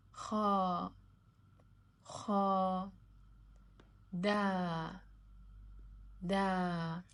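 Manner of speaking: each long syllable called out loud and clear; loud classroom recitation of long vowels. Shown as a demonstration. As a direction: drill the letters for students to repeat. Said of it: "long sound letters".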